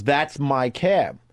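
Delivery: slow, drawn-out "That's my cab," lightly voiced pushy stranger; rude guy's claim. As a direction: stretch "That's my cab" slowly, like a mild mock of an entitled stranger. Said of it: "this guy comes from nowhere and goes".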